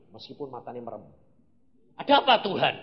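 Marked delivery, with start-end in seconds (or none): 1.9-2.7 loud, higher startled question; startled reply. Retold or imitated. Preacher replays being woken by God, startled. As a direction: answer as if jolted awake, loudly. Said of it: "Kaget aku".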